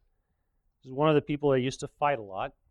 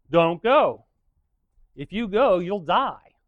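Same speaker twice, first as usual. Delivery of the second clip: louder, pressing prophet's warning; urgent warning; 3 semitones higher, 7 dB louder, slower than usual. Retold or imitated. Micaiah warning the kings against battle, urgent and louder. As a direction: warn them hard not to go.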